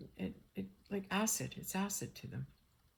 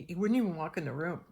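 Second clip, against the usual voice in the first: normal talking voice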